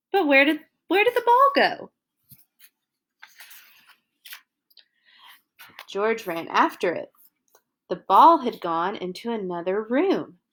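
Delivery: put-on lively read-aloud for little kids; reading to children. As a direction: tell it like a bedtime storybook. Reads picture book to children.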